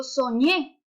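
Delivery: soñé with a strong rising ÑÉ; stress on last syllable. Shown as a demonstration. Teacher showing where the force falls, soñé stressed on ÑÉ. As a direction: put the force of soñé on its final ñé. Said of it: "¿Dónde hay mayor fuerza de pronunciación?".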